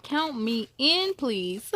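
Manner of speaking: usual speaking voice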